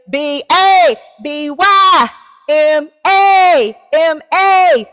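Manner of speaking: slow, loud, high letter-by-letter shout; shouted spelling. Real, not acted. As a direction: spell it out like a hype call to the crowd.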